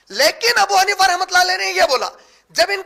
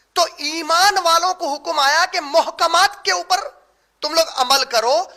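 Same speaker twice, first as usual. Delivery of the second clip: ordinary talk, nothing marked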